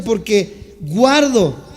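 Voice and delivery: ordinary talk, nothing marked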